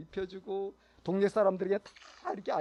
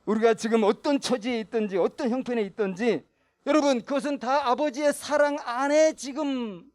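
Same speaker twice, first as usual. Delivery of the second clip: loud, high, fervent proclamation; full-force preaching; 6 semitones higher, 12 dB louder than usual. Acted. Preacher assuring the congregation of the Father's love, fervently. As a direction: lift the congregation with conviction.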